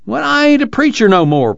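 high-pitched, reluctant objection; high, earnest refusal; protesting. A narrator's reading. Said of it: "Casey protested".

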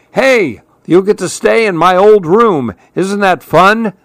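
high, upbeat dad voice; enthusiastic dad. A narrator's reading. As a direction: sell it cheerfully to a reluctant child. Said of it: "That's her dad".

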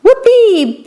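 high squeaky cheer of delight; squeaky whoopee. A narrator's reading. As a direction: shriek it up high with joy. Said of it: "Pip squeaked".

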